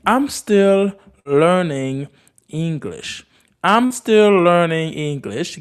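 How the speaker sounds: very slow, separated words; slow model phrase. Shown as a demonstration. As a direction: spell it out slowly for learners. Teacher models phrase for learners.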